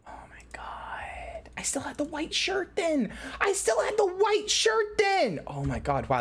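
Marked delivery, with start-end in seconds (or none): 0.1-3.1 breathless whisper of awe; awed whisper. Real, not acted. Streamer seeing her old avatar, awed whisper.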